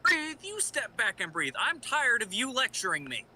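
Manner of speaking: sharp, angry throw-back of her words; angry outburst. Acted. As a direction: fling the advice back at her in anger. Robin snapping back at Batgirl.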